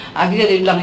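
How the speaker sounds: normal talking voice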